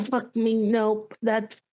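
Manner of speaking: ordinary delivery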